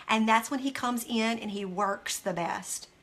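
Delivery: ordinary delivery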